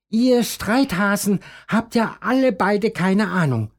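high know-it-all rabbit voice; lightly voiced bossy neighbour; Hasen Tina. A narrator's reading. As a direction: scold the two from the fence, pitched high. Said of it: "meint sie".

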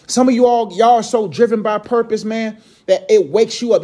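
usual speaking voice

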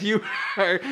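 laughing mock dad. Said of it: "He's like".

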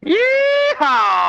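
loud high yeehaw whoop; cowboy yeehaw. Real, not acted. Cowboy guest whooping on arrival.

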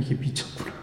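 breathy inner whisper of shock; daughter's private whispered thought about her mother. Retold or imitated. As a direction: whisper it to yourself, stunned. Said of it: "이 딸이 보기에는".

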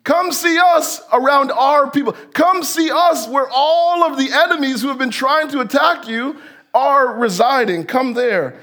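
pushy shout, pulling him onto their turf; mock insistent calling. Enemies pressing Nehemiah to come, mock shouting. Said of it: "So they're like".

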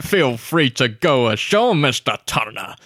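slow percussive villain delivery; drawn-out, theatrical sea-captain menace; Davy Jones impression. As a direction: savour every phrase, popping the consonants. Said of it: "That, that percussive sound as well".

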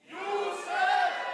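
a loud, high call of the name; group shout. Real, not acted. The crowd calls Josef a second time.

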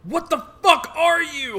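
high, acted angry yell; alarmed outburst. Fighter confronts ghost that grabbed her, alarmed outburst. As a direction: demand it in high-pitched alarm.

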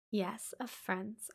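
slow, hesitant whisper. A narrator's reading. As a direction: hold back the word, barely breathing it. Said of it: "I answered, rather hesitatingly".

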